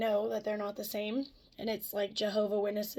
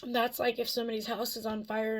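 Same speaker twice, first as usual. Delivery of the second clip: ordinary talk, nothing marked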